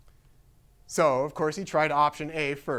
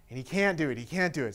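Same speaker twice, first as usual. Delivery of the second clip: ordinary delivery, nothing marked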